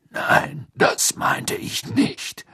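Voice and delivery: slow, breathy rasping growl; deep rasping growl. Acted. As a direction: growl like a grizzled auror. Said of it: "sagte Moody".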